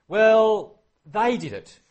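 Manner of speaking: high, loud whiny excuse; high defensive whine; defensive protest. Retold or imitated. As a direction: blurt it out, pointing the finger. Saul shifting blame.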